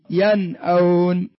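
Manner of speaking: slow "ينأون" with a clear ن before the hamza; إظهار النون. Shown as a demonstration. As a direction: split "ينأون" after a fully sounded ن, drawn out. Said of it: "ظهرت النون ولا ما ظهرت؟". (نطق "ينأون" ببطء مع إظهار النون قبل الهمزة)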